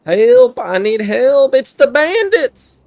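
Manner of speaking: high, loud acted alarm call; hammed-up high-pitched yell for rescue; cry for help. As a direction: call out for help, stretching the last words. Acts character calling for help.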